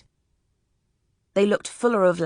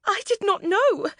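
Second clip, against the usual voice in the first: much higher, distressed lady's voice; a shaken lady answering the officer; frightened lady; 14 semitones higher than usual. A narrator's reading. The memsahib answering the officer, higher and shaken. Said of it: "the memsahib said".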